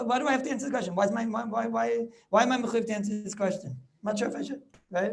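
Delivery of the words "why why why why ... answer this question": ordinary talk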